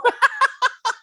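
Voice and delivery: a quick high burst of laughter. Real, not acted. Singer laughing at his own reply, high burst of laughter.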